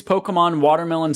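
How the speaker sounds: ordinary talk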